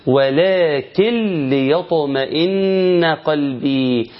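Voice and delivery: slow recitation with noon merged into lam; Quranic idgham. Shown as a demonstration. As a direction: draw out "ولكن ليطمئن" with the noon swallowed into the lam. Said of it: "فتقول". (تلاوة بطيئة مع إدغام النون في اللام)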